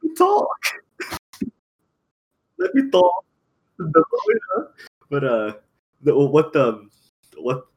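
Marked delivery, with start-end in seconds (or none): 0.0-3.2 squeaky, much higher, louder mimicry; mocking high voice. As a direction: parrot him in a high whiny voice. Mocking a friend's interruption.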